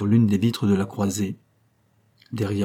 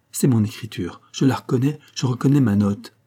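plain reading voice

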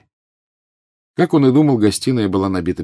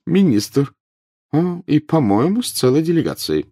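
higher professor, announcing; lightly voiced professor; 10 semitones higher, 3 dB louder than usual. A narrator's reading. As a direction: report the arrival in a professor's higher voice. Professor announces arrival at window.

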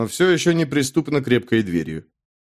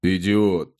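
deadpan, lower voice; flat, colourless reply; 5 semitones lower than usual. Acted. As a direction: say it with zero emotion. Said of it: "бесцветно ответил Велфорд". (бесцветно, без эмоций)

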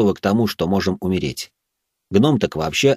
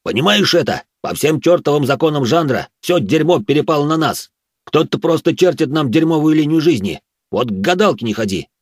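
angry dwarf; 7 semitones higher than usual. Acted. Dwarf ranting.

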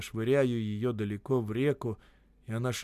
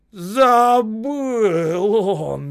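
high, loud, long-drawn roar; furious shout; 10 semitones higher, 12 dB louder, much slower than usual. A narrator's reading. Боев disbelieving Осип, furious shouting. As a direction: stretch the words out in rage. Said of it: "яростно кричал Боев".

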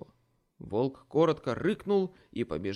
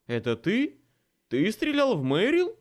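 stunned; 10 semitones higher, 9 dB louder than usual. A narrator's reading. Hvat confronts Ace.

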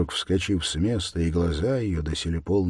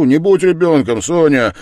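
loud, higher, insistent; exasperated reproof; 10 semitones higher, 13 dB louder than usual. A narrator's reading. Man rebuking Sonya once more, exasperated. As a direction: raise the voice to scold. Louder repeat of earlier reproof.

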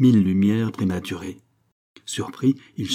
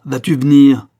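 much louder, higher angry demand; furious father's booming call; thunderous shout; 5 semitones higher, 12 dB louder than usual. A narrator's reading. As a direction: bellow it at the boy. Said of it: "d'une voix de tonnerre".

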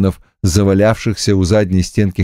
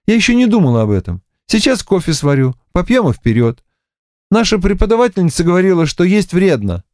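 fast chatter; 9 semitones higher, faster than usual. Acted. Olga chatters quickly.

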